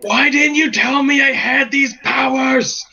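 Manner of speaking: high, indignant mock yell. Adventurer demanding answers from his sword, yelling. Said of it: "hear me yelling to my sword".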